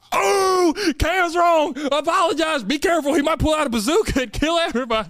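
high mock announcer. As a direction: tease him in a high, grand voice.

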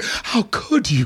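slow reproach. Retold or imitated. Mother's look voiced.

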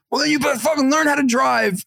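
mild mock yell; retold dad scream. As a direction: imitate an exasperated father yelling, held back in volume. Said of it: "screamed at me".

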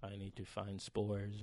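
slow, quiet zombie drone. Retold or imitated. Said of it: "you just walk around like".

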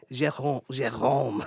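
"Jérôme" with a throaty French R; practising the back-of-throat French R in "Jérôme". Shown as a demonstration. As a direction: say "Jérôme" with the R made at the uvula. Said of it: "that R in French".

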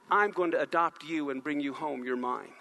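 dropping low and soft, almost hushed; tender adoption. Acted. God claiming an orphan child, low and tender. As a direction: hold them close and say it gently.

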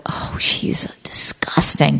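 slow disgusted whisper; a slow breathy sneer; mock whisper. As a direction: whisper it like a judgy viewer. Viewers judging her commercial.

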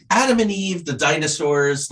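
put-on high-pitched child listing puzzles; retold kid voice, high at the start and easing down; second-grader questioning. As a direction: imitate a curious young schoolkid, pitched well up. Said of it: "however a second grader or third grader would say that".

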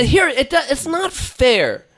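high, slow whine; whiny complaint. Real, not acted. Complaining to friends about Dave, high slow whine. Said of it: "you sounded like the bad guys from The Big Lebowski".

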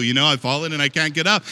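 plain comic quote, loud. Pretend. Repeating the ad line louder.

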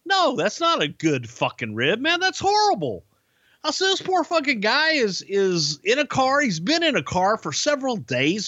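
high, heated outrage; indignant scolding. Retold or imitated. Bruce scolding Pete over the mean joke, high and outraged. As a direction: tear into him for the cruel joke.

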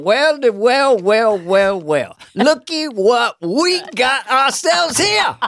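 put-on jeering yokel sneering at a city boy; Chris Rock voice. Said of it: "I sound like Chris Rock".

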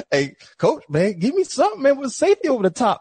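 high-pitched mock pleading with coach; imagined plea. Defender silently wishing the coach would send help, mock pleading. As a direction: voice a struggling player's urgent inner appeal, pitched high. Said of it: "in your mind, you be like".

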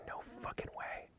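very soft, disbelieving whisper; whispered refusal. Retold or imitated. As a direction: imitate a buddy whispering in disbelief. Buddy refusing, out of earshot.

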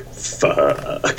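breathy whispered dismay; whispered curse. Pretend. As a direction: hiss it under breath. Acted dismay, unvoiced.

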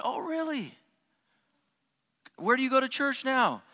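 high, bright surprised oh really, held way up; retelling his own excited surprise; delighted surprise. Retold or imitated. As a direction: jump up in pitch, thrilled he's a believer. His own surprised reply, retold.

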